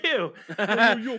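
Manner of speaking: high, loud laughing yo yo; laughing robot-sidekick yo; Alpha Six catchphrase. Retold or imitated. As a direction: toss off the robot's greeting through a laugh.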